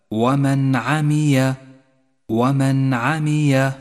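ومن عمي chanted slowly with a clear ن before ع; clear izhar. Shown as a demonstration. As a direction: recite ومن عمي slowly, no nasal hum on the نون. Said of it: "أي هكذا". («ومن عمي» بإظهار النون من دون غنة)

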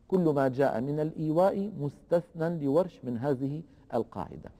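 normal talking voice

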